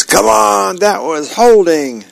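indignant sports-fan dad yelling at the TV; mock yell. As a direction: yell it like an outraged football fan. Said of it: "and yells".

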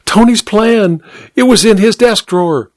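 high, urgent; tearful rush; excited. A narrator's reading. Tearful housemaid bursts in.

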